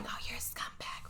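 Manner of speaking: voiceless mock-disgusted whisper; whispered contempt. Viewers react to the cheating husband, whispered contempt. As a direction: whisper the insult under your breath.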